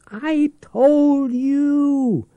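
high, drawn-out screamed I told you. Acted. The Peeper screams at his old enemy, shrill and loud. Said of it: "the Peeper screamed".

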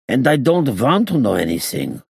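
put-on high, emphatic accented protest; agitated denial. A narrator's reading. Gangster refusing to be involved, high agitated voice. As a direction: insist hotly in a high foreign accent.

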